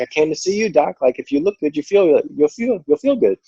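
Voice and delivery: playful, cheery self-quote; upbeat. Retold or imitated. Retelling her cheerful reply to doctor.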